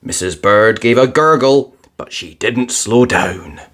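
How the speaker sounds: usual speaking voice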